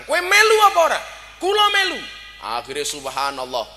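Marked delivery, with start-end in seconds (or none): 0.0-1.0 very high, loud shout; shouted challenge. Acted. Prophet asking the convert to join battle, shouted challenge. 1.4-2.0 high, loud, instant reply; shouted volunteering. Acted. New convert volunteering for battle, shouted volunteering.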